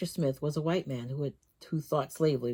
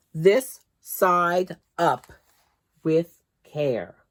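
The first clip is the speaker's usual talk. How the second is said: emphatic reading; 7 dB louder, much slower than usual. A narrator's reading. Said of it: "printed on the crate in large letters".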